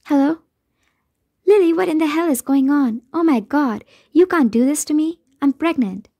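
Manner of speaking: worked-up friend demanding answers on the phone; frantic friend. Acted. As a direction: burst out in alarmed exasperation, pitch swooping.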